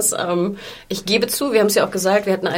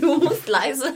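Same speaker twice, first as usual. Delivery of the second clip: normal talking voice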